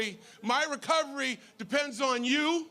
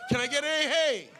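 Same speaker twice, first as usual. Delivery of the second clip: shouted call to the crowd. Real, not acted. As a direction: call out for a hey. Calls for crowd response.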